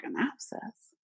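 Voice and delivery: quietly taken aback, pitch lifted; puzzled echo. Retold or imitated. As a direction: echo it back as a baffled question. Echoes the news as a question.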